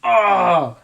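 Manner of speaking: a mock gut-punch reaction; stunned gasp. Viewer reacting to the daddy line, with a sudden high loud oh. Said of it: "you're just like".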